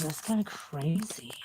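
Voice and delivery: slow amazed whisper; hushed, slow, under the breath. Real, not acted. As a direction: breathe it out in awe. Hearing herself in subwoofers.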